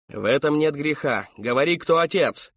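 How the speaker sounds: loud, high, forceful order; screamed demand. A narrator's reading. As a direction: yell for the answer. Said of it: "завопила миссис Рэдклифф".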